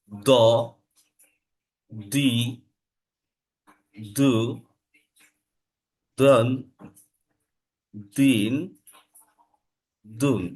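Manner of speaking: দ, দি, দু each said alone with a d sound; isolated model syllables from দ to দুন; alternate Dad pronunciation. Shown as a demonstration. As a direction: recite দন, দিন, দুন one by one, deliberately. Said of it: "আবার এটার উচ্চারণ দল দিয়েও হয়".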